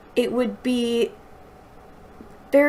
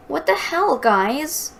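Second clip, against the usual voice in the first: higher, indignant character shout; 7 semitones higher, 4 dB louder, slower than usual. A narrator's reading. Maggie yelling at her friends over the killing, indignant shout. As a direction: snap it out at the boys, outraged. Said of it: "Maggie shouted".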